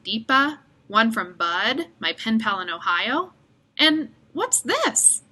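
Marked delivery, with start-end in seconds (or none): 3.8-5.2 slow, higher wondering question; surprised. Acted. Boy spotting a mystery card, slow surprise. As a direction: draw it out in surprise.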